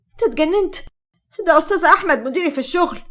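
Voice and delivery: high-pitched, tearful protest. A narrator's reading. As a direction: protest through a touch of tears. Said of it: "ليلى بدموع". (بنبرة باكية)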